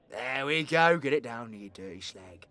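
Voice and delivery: ordinary talk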